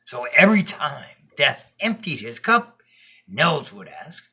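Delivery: usual speaking voice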